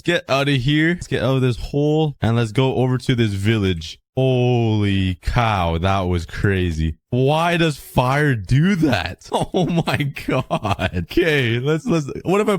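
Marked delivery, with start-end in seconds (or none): none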